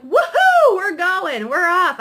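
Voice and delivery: very high, loud whoop of glee; whooping witch. Acted. Witch setting off on her broom, whooping with excitement. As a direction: take off with a shrieked cheer. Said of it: "And this witch is like".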